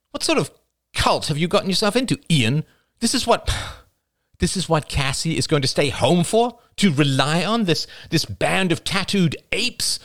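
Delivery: high, incredulous tirade; scornful, high-pitched questioning; indignant. A narrator's reading. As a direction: berate in disbelief with pitch well up. Rachel berates Ian in a quarrel.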